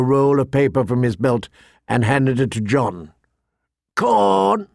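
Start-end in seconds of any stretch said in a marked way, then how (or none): 4.0-4.7 high, drawn-out bird croak; raucous raven. A narrator's reading. Raven begs the Lord Commander for food, raucous. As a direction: squawk it like a raven. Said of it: "it muttered in a raucous voice".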